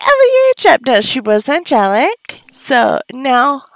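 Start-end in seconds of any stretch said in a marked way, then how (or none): 0.1-0.8 high, louder yelled praise; delighted shout. Real, not acted. Cheering her Sim Ellie after aging up.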